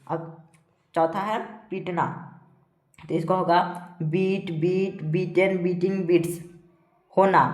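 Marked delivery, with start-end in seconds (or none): 4.0-6.4 evenly spaced word-by-word list; steady classroom drill; verb drill recitation. Shown as a demonstration. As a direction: recite each form separately for pupils to memorise. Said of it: "पढ़ पढ़ के आप लोग को बताएंगे".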